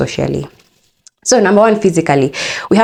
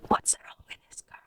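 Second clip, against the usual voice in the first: whispering onlookers; 19 dB quieter than usual. Retold or imitated. Bystanders gossip about crying girl.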